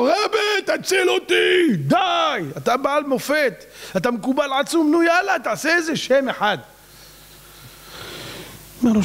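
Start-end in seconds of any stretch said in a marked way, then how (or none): 0.0-6.6 desperate plea. Retold or imitated. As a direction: beg the rabbi, pitched high. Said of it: "רצה להשתגע".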